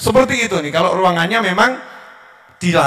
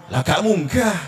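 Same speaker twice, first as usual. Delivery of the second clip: normal talking voice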